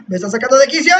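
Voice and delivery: ordinary talk, nothing marked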